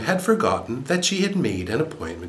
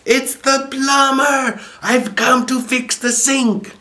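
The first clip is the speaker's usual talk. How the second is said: far higher, louder, slow furious scream; yelling at the top of lungs; 6 dB louder, slower than usual. A narrator's reading. Plumber in a hissy fit screaming at the door, furious yell. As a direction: scream it in a frenzy. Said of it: "yell at the top of his lungs".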